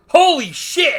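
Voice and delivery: very high, loud exclamation; mock startled shout. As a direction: yell it like a hammy movie villain. Acts out villain's startled exclamation.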